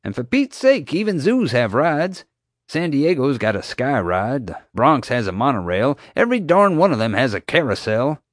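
park owner defending rides; exasperated. Acted. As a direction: argue his case, fed up.

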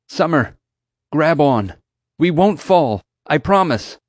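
insistent, emphatic call; urgent urging. A narrator's reading. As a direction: urge a frightened friend to trust you. Urging her to trust his grip.

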